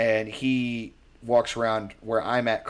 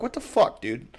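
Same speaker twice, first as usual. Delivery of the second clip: mock disbelief; 8 semitones higher than usual. Disbelief at Best Buy bodybuilder.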